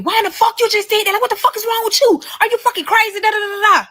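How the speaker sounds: high-pitched, rapid imitation of a man yelling; mock yelling. Woman mimics her child's father after the wreck, mock yelling. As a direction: rattle off his outraged questions way up high. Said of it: "So he yelling at me".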